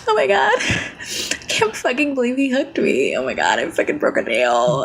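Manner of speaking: hammed-up valley girl meltdown; valley girl freaking out. Shown as a demonstration. Streamer acting out getting hooked in a game, valley girl freak-out. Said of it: "start, like, freaking out".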